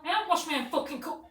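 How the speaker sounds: high shouting imitation of outrage; acted furious yell. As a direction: explode into a mock accusation at full volume. Retold furious accusation over shampoo.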